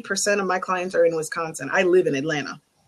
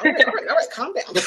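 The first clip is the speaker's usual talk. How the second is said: mock placating; 8 semitones higher, faster than usual. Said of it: "And I'm like".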